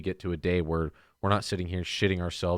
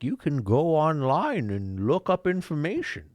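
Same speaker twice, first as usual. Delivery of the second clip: put-on old internet voice; 7 semitones higher, slower than usual. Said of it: "the internet was like".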